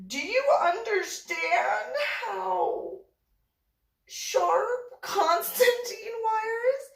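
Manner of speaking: high, slow, emphatic disbelief; incredulous warning. Retold or imitated. Photographer warning model about sharp wire, incredulous. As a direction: drag out each word as an aghast imitation.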